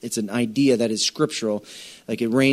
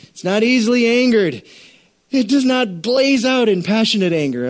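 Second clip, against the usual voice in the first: high and loud on easily angered; proclaiming the verse; forceful scripture; 9 semitones higher, 5 dB louder than usual. Acted. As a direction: preach it with mounting heat.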